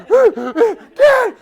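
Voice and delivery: sudden high loud cry; startled yell. Retold or imitated. Runner startled by dog chasing rabbit, loud high yell. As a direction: call out in alarm.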